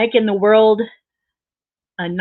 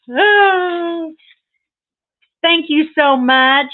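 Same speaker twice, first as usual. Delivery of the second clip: gushing thanks; 9 semitones higher, 4 dB louder, much slower than usual. Real, not acted. Thanking for a gift.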